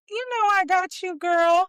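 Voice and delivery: high, loud imitation of the stylist; mock sassy stylist. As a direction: pitch it way up, buddy-buddy. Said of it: "And she's like".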